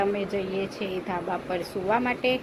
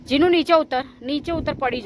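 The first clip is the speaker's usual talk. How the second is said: sharp warning; 5 semitones higher, 6 dB louder than usual. Real, not acted. Scolding child to climb down.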